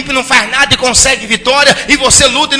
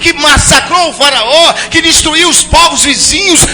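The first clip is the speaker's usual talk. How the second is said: high, loud, pleading shout; Gideon's anguished outcry; 6 semitones higher, 3 dB louder than usual. Acted. Gideon demanding to know where God is, shouting. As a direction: cry out to a silent God. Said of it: "Gideão devia tá falando com ele mesmo".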